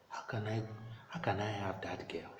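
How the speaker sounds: near-whispered musing to oneself; acted private thought, very soft; hushed soliloquy. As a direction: ask yourself softly, almost under your breath. Priest's soliloquy, alone with his thoughts.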